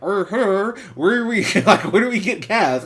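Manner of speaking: high, loud character impression; Medea. Medea asking a stranger where to get gas, impression. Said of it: "Medea goes like".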